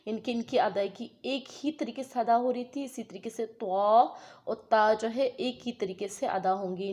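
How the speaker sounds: ordinary delivery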